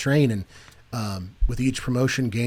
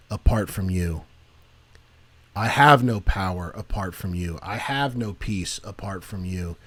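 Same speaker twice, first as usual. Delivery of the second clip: lightly voiced wretched supplicant begging the Lord; humble prayer; 4 semitones lower than usual. Shown as a demonstration. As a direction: pray it humbly on your knees. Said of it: "and you say".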